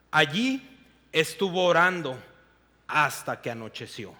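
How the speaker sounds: expressive storytelling read of scripture; dramatic scripture reading; Bible story narration. Acted. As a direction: read the Gospel like a vivid story to the congregation. Reads Gospel passage aloud.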